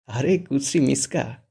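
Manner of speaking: slow, drawn-out mock name-calling; teasing laugh. A narrator's reading. Ratul teasing Miska, slow and mocking. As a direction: tease her slowly with a grin. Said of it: "তাকিয়ে হেসে বলে".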